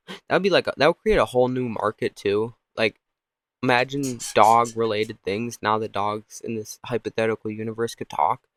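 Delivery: ordinary talk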